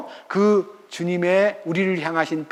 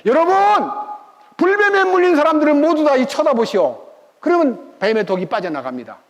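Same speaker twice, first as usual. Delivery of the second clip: louder, higher proclamation to the crowd; calling out; 7 semitones higher, 4 dB louder than usual. Retold or imitated. Moses calling out to the bitten people, loud. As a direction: shout for everyone to look. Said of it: "쳐다보라고 소리를 지르는데".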